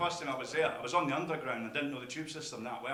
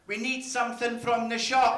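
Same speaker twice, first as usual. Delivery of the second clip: slow, high, loud wife voice; imitated wife; 7 semitones higher, 7 dB louder, slower than usual. Wife in pyjamas hinting to husband, slow raised mock voice. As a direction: draw it out as a mock announcement. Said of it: "I'm gonna hear the immortal words".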